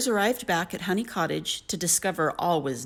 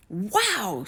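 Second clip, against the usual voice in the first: higher-pitched, wondering exclamation; amazed; 4 semitones higher than usual. A narrator's reading. Jack seeing the enormous beanstalk, amazed. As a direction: let the wow out in wonder.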